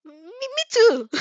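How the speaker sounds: high, slow, timid little voice; shy girl. A narrator's reading. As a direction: squeak it out, shy but stubborn. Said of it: "clearly shy".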